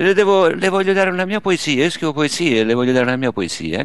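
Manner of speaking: put-on high-pitched voice of an old gentleman; lightly voiced elderly poet; quoting the old man. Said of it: "Però questo signore dice". (signore anziano con voce acuta)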